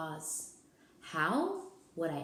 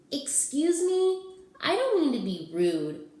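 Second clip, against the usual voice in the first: put-on kid voice; 9 semitones higher, 6 dB louder than usual. Kid asks Ralph.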